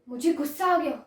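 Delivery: ordinary talk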